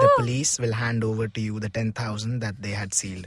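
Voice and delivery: judge's verdict. Pretend. Friend plays the judge, softly pronouncing the verdict. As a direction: read the verdict like a kindly judge. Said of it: "I'm the judge".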